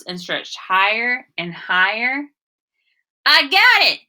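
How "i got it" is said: high, loud triumphant call; shout. Acted. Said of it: "he shouted".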